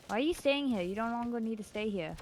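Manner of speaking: normal talking voice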